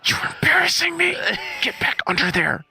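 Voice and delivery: mock frantic mom hissing then shrieking; a hammed-up embarrassed mother scolding the dog; flustered Walmart mom. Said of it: "flustered mom in Walmart sound".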